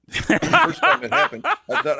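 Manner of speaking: real laugh while telling a story. Real, not acted. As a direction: breaks into laughter as he tells it. Recalling first time, laughing.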